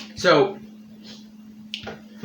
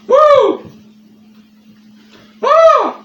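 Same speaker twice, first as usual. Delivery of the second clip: pained whoop; 11 dB louder than usual. Real, not acted. Burning mouth reaction.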